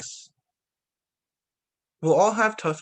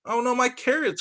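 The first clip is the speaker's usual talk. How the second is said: Rabbit crying out; 9 semitones higher than usual. A narrator's reading. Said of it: "cried Rabbit".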